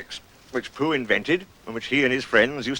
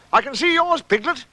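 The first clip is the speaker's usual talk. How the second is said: high, excited call; high-pitched, eager; sudden discovery; 13 semitones higher, 3 dB louder than usual. Acted. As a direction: announce a sudden sighting in a raised voice. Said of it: "said Pooh suddenly".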